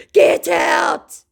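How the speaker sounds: high, loud put-on shout; mock yell. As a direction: bark him out of the chat in a silly voice. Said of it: "he yells at me".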